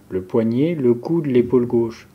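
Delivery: normal talking voice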